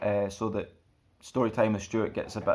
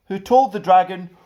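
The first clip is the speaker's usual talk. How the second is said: lively, sing-song storybook reading; children's story narration; 6 semitones higher, 4 dB louder than usual. A narrator's reading. Stuart reading a picture book to children, lively. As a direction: read it like bedtime storytime for kids.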